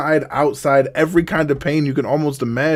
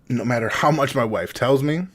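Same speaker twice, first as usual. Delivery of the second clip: ordinary talk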